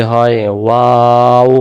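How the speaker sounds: ওয়াও held long from between the lips; ওয়াও stretched very slow; drawn-out waw. Shown as a demonstration. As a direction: model ওয়াও slowly. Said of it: "দুই ঠোঁটের মাঝখানের ফাঁকা থেকে".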